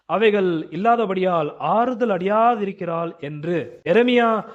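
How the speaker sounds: slow, high, declaimed Bible reading; proclaiming scripture to a congregation. Acted. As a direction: read the verse out slowly, voice lifted high. Reads a Bible verse aloud.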